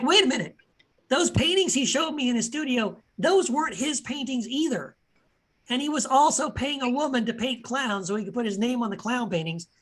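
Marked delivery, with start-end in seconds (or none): 0.0-4.9 higher-pitched, imitating someone's disbelief; astonished realization. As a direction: act out the penny dropping, pitched up. Acted quote of a dawning realization.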